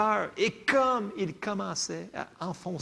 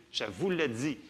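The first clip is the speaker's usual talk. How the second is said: ordinary delivery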